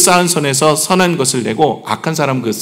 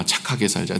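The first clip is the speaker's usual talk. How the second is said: soft slogan; 9 semitones lower, 10 dB quieter than usual. Shown as a demonstration. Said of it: "우리 같이 한번 해볼까요?".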